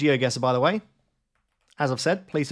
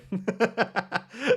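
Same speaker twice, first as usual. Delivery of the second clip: high-pitched burst of laughter; amused laugh; 10 semitones higher than usual. Real, not acted. Streamer seeing himself massive on screen, giggling laugh. Laughs at huge camera picture.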